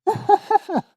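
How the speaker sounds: high, loud burst of laughter; laughing. Real, not acted. Reader cracking up at a silly line, high laughter. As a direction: crack up helplessly.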